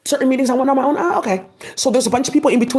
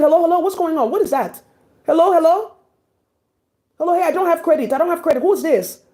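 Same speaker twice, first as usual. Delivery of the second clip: higher, frantic hellos on the phone; panicked phone call; 7 semitones higher than usual. Retold or imitated. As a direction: imitate a scared friend calling out for help. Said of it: "she was screaming".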